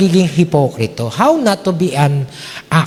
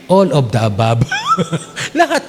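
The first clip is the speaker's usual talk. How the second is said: laughing. Real, not acted. Laughing at his own joke.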